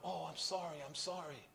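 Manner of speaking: high, hushed retold apology; anxious apology. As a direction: act a small, worried kid apologizing. Acting his frightened boyhood apology.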